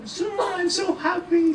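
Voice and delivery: very high, slow gushing; giddy student. Acted. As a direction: squeal the happy news to the teacher. (tuwang-tuwang estudyante, matinis)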